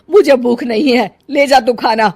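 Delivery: irritated refusal. Acted. Mother refuses food.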